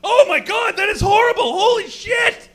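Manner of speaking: high, loud shriek of horror; hammed-up hysterical yelling; panicked shout. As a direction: scream it in sheer panic. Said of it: "I'm starting to panic".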